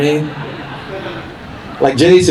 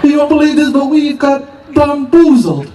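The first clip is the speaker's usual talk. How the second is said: very high British survivor, slowing to the end; hammed-up British accent, stretch out the last word; mock British accent; 13 semitones higher than usual. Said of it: "excuse the accent".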